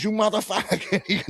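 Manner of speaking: high, slow mock outrage; angry buddy. Retelling the buddy's reaction, high and slow. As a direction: ham up the buddy's fury. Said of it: "And then he goes".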